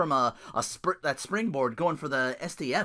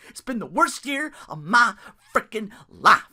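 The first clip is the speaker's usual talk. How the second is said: higher, louder put-on whine; mock wrestler lament; 6 semitones higher, 6 dB louder than usual. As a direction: ham up a wrestler's misery.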